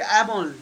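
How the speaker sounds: Amol with a stressed cat vowel first; English reading of Amol. Shown as a demonstration. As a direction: anglicise the name Amol. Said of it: "If I read your name in English".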